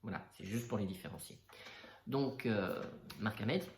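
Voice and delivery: normal talking voice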